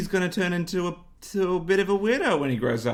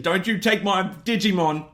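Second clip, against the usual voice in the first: higher, louder mock tantrum; rage and intensity; 5 semitones higher, 3 dB louder than usual. Young [name] guarding his Digimon, mock childish rage. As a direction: protest like an intense young boy. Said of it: "That rage and intensity".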